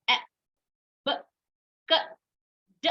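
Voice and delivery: ए, ब, क, ड as brief, separate phonic sounds; short half sounds. Shown as a demonstration. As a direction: cut ए, ब, क, ड short, pausing after each. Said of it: "आधा sound ही बोल रही हूँ". (ए, ब, क, ड को छोटी आधी आवाज़ में, रुक-रुककर)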